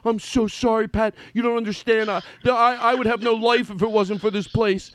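mock a desperate, over-grateful employee begging forgiveness; imitating grovelling apology. Friend mimics Mike apologizing to the boss, hammed-up grovelling. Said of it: "here's my impersonation of you".

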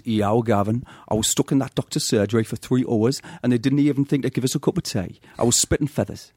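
a light Geordie nan voice; a Geordie nan complaining, lightly done; Geordie accent. Retold or imitated. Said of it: "it goes like this".

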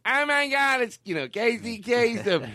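high, excited fangirl gush; mock starstruck gasp; gushing fan. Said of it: "Janine Garofalo goes".

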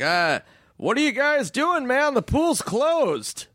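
high, drawn-out voice of a put-on guard; high-pitched mock security guard; old security guard. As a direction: imitate a guard calling out that it's over, stretching the last words. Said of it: "This old security guard's like".

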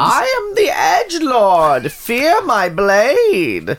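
hammed-up menacing villain, slow; edgelord villain. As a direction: declare it like a cartoon villain.

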